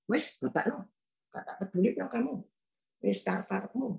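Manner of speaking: sulky angry outburst. Retold or imitated. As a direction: snap it off quickly, sulking. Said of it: "Saya ngamuk, sewot". (ngomel cepat dan lirih karena sewot)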